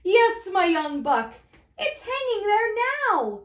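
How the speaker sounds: put-on high young boy's hello. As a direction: pitch up to a child. Boy voiced in the reading.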